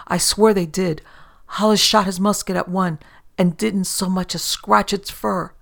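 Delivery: frantic merchant swearing it's true; desperate insistence. Acted. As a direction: make them believe you.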